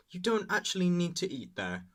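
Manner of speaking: usual speaking voice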